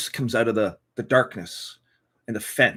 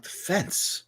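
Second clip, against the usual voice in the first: whispered; 6 semitones higher, 7 dB quieter than usual. Retold or imitated. Whispered radio reply.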